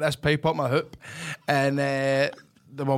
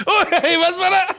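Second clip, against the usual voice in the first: higher, louder, slow words through laughter; laughing; 13 semitones higher, 5 dB louder, slower than usual. Real, not acted. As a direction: crack up mid-line. Mocking his co-host, laughing.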